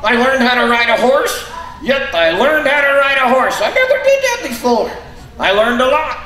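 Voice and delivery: high, squeaky puppet voice; squeaky ventriloquist dummy. Acted.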